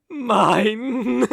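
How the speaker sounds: loud, high, breaking towards laughter; manic possessiveness. Acted. Captor claims his rabbit, manic possessiveness. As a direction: let the word burst out.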